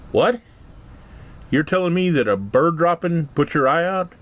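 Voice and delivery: high, disbelieving what?; incredulous. Acted. Dad reacting to the pirate's answer, disbelieving. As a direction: sell the dad's disbelief.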